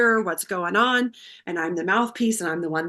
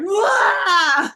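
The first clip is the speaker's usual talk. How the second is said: sudden loud high wail, half laughing; mock crying wail; 10 semitones higher, 7 dB louder than usual. Daughter breaking down when the chaplain asks to talk, mock wailing. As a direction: burst into a hammed-up sob. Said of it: "And I am just like".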